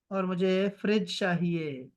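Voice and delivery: ordinary talk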